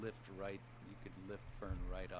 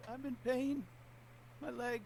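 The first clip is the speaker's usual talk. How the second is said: a trapped mother's voice calling for her son; ghostly mother voice; 14 semitones higher than usual. Acted.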